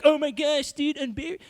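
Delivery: hammed-up panic as the water gets close; mock panic. Fish freaking out as the water gets real close. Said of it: "He freaks out".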